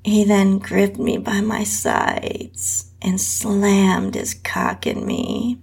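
usual speaking voice

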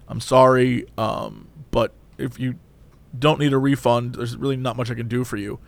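low, soft, apologetic worker; lightly voiced nervous cashier. Cashier apologising to Karen, low and soft. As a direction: mumble an apology like a timid cashier. Employee voiced in retold story.

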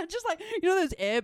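usual speaking voice

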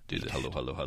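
quiet, throwaway muttered hello. Acted. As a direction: mumble it under your breath. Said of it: "I actually did".

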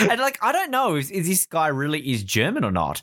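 ordinary talk, nothing marked

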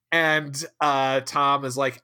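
usual speaking voice

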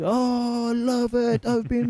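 mocking the big bald Mexican greeting them in the shop; the Mexican guy. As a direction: greet them like an overfriendly stranger walking in. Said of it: "I'm from America".

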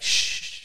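long unvoiced shush; drawn-out "shh" to hush someone. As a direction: shush them to keep a secret. Hushing the kebab guy.

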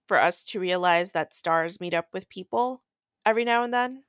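normal talking voice

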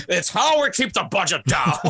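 hammed-up Scottish star's reply; Connery. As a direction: answer in a put-on burr.

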